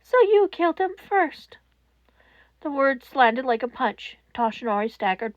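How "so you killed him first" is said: cold accusation. A narrator's reading. Netsu accuses Toshinori.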